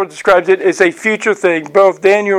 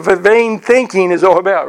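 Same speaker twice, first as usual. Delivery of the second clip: usual speaking voice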